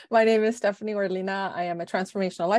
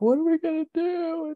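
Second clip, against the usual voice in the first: retold crying; 10 semitones higher than usual. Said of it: "And I'm crying".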